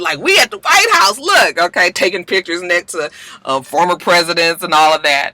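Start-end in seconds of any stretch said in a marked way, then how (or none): 0.2-1.6 much higher, giddy show-off; excited. Retold or imitated. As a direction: brag like a tourist on a big day. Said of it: "they all excited".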